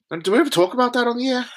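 rapid high-pitched complaint; worked-up rant. Real, not acted. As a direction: fire it off indignantly. Ranting fast question.

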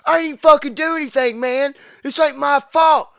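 high, riled-up mock yell; retold yelling. Matt in the cop car yells at his friend, high mock shouting. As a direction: shout it through a car window. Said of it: "yelling at me through the window".

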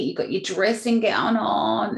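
normal talking voice

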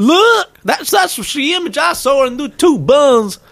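put-on very high, slow Texan old-timer; hammed-up grandad telling his love story; Texan grandfather. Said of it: "I've got a Texas accent".

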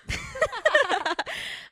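high, loud helpless laugh; loud burst of laughter. Real, not acted. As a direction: break into a big laugh. Burst of laughter mid-talk.

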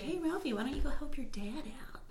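quick, very soft coaxing; hushed mother. Retold or imitated. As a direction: imitate a mother's gentle suggestion in a near whisper. Mother's quote, acted in retelling.

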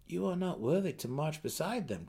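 a touch of dripping sarcasm; mock the warden's snub lightly; sarcastic. Drizzt teasing Belwer about the warden, mildly sarcastic. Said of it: "his tone dripping with sarcasm".

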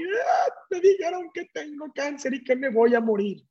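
high, crying outburst, reenacted; hammed-up sobbing cry on a high pitch; acted tearful wail. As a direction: break into a high wail as you tell your wife. Breaks down on phone to wife.

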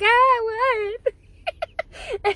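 high, loud startled yelp. Retold or imitated. Caught off guard by a staring stranger, high startled yelp. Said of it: "I was like".

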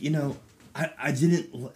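ordinary delivery

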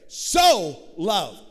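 So loved called out with force; emphatic. Shown as a demonstration. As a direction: lean hard on So. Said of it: "Stop right there".

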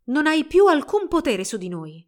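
high, loud declaration; defiant. A narrator's reading. Giselle defies the spirit queen.

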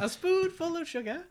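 very high, prim little voice; high British singsong. Pretend. Carrying on the accent bit, high singsong. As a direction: pipe it up sweetly in mock British.

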